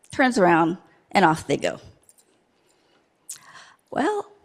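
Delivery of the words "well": much higher, softer, sighing well; a high, rueful little girl letting it go; resigned girl. Acted.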